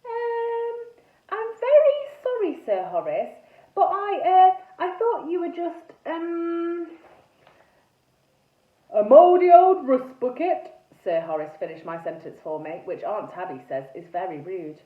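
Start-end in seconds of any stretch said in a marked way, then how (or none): 0.1-6.9 high, careful, hesitant apology; best polite voice. A narrator's reading. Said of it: "in my best polite voice". 8.9-10.7 loud, raised, booming interruption; indignant knight ghost. A narrator's reading. Ghost cuts in, offended.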